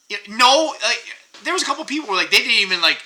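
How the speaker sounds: ordinary talk, nothing marked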